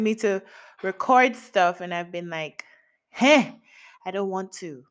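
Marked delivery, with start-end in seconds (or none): none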